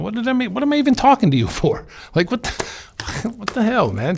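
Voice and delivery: exasperated and half laughing at his mentor; exasperated outburst. Retold or imitated. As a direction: throw up your hands in mock exasperation.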